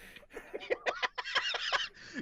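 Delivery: high squealing laughter. Real, not acted.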